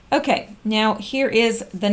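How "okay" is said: usual speaking voice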